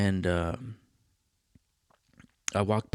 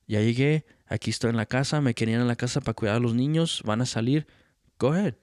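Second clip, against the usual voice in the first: put-on cheeky answer to the drunk father; same tone; 2 semitones higher, faster than usual. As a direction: throw his own tone right back at him. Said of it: "the same tone, right? I'm telling you guys".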